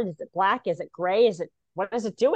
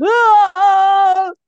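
real laugh; 9 semitones higher, 11 dB louder than usual. Real, not acted. Laughing at own joke.

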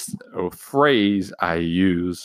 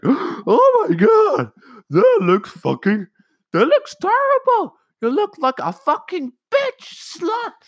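shrill, much higher hammed-up mom shriek; mocking mom; 5 dB louder than usual. Mom horrified by son's eyebrow cut, mock shrieking. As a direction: parody a horrified mother. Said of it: "My mom was like".

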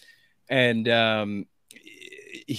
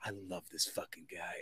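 hushed aside; 6 semitones lower, 19 dB quieter than usual. Retold or imitated. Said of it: "And I was just like".

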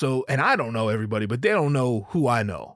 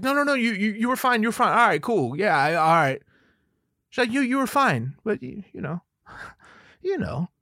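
much higher, hedging, halting wife voice; hesitant wife; 8 semitones higher than usual. Retold or imitated. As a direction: play his wife dodging the answer nervously. Wife reassuring, voiced high.

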